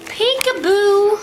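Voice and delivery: high, slow peekaboo; high sing-song peekaboo. Real, not acted. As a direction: coo at the cat playfully. Playing peekaboo with cat.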